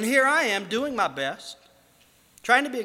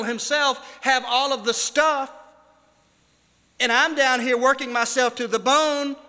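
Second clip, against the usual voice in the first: higher, louder impassioned preaching; preaching at full force; 7 semitones higher, 7 dB louder than usual. Acted. Preacher voicing a believer's complaint to God, impassioned and loud. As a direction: pour out the complaint to God from the pulpit.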